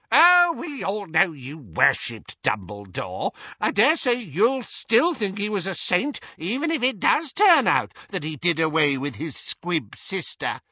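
put-on high, scornful old busybody; high-pitched elderly mockery; taunting old aunt. A narrator's reading. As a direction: needle him in a shrill old voice. Muriel taunts Doge.

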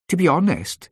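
high, broad Welsh receptionist; broad Welsh accent. A narrator's reading. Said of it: "she said in a broad Welsh accent".

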